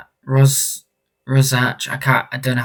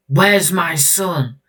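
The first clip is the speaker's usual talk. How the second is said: slow, higher-pitched demand; acted parental demand; 7 semitones higher, 8 dB louder, much slower than usual. Parent to stranger about a missing son, urgent drawn-out demand. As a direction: stretch it out high, demanding an answer.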